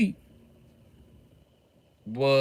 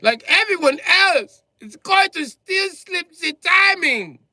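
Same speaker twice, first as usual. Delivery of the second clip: much higher and louder, slowed to hammer it home; outraged yelling; 9 dB louder than usual. Real, not acted. Lecturing German engineers on timing chains, slow yelling. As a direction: yell every word slowly.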